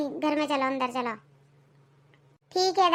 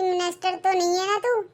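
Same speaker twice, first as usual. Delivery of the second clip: ordinary talk, nothing marked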